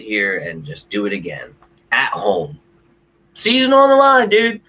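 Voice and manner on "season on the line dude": much higher and louder, yelling in disbelief; full-throated fan outburst; exasperated shout. Real, not acted. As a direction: blow up over the loss. Ranting about the lost game.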